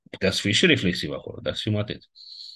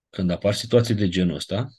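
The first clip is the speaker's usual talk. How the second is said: usual speaking voice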